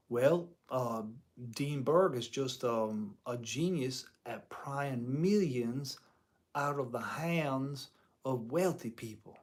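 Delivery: a hint of a slow Southern drawl. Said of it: "southern drawl".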